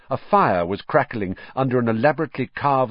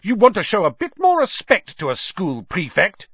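much higher, louder indignant prefect; outraged; 10 semitones higher, 4 dB louder than usual. A narrator's reading. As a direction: bristle with offended pride. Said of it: "Percy looked outraged".

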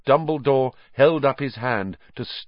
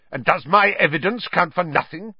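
high, indignant snarl; 9 semitones higher, 3 dB louder than usual. A narrator's reading. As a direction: snarl it as an offended challenge. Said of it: "snarled Snape".

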